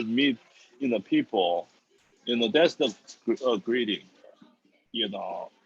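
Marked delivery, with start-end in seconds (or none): none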